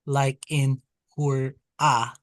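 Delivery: "hur-a" said slowly, open "a" on both syllables; slow example word. Shown as a demonstration. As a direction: stretch out "hur-a" as a model. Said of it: "this vowel makes the sound a".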